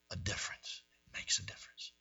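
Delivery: whispered repeat; hushed. Real, not acted. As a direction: breathe the words softly. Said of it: "softly repeated verse".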